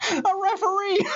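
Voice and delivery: "A referee" blurted out high, with a laugh; laughing punchline. Acted. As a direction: burst out the answer while cracking up.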